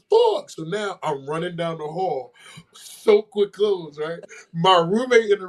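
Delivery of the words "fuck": high sharp curse; retold panic curse; dismayed curse. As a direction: spit it out in disbelief. Second realization in shower.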